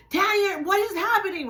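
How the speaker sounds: high-pitched mock yell of mom; retold yelling. Mom on the phone demanding answers from Tanya, mock yelling. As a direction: call out in alarm, imitating mom. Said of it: "you could hear my mom yelling on the phone".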